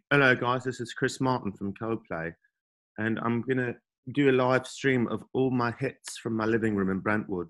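hushed, polite British pop star. Retold or imitated. Greeting fans from his Brentwood living room, soft British accent. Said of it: "He's British, isn't he?".

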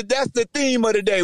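ordinary talk

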